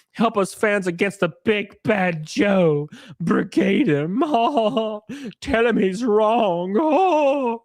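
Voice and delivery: slow, whiny mocking read of the tweet; mock whiny fan. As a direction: drag it out like a helpless crybaby. Mockingly reading the fan's tweet.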